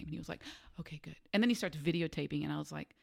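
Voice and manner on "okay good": much softer, relieved little okay; relieved reply. Retold or imitated. Contractor relieved she won't talk shop, soft relieved reply. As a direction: let the relief out under your breath.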